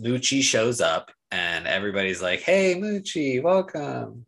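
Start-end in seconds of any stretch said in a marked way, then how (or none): 2.4-4.2 mock character greeting. Voicing a character greeting Muchi.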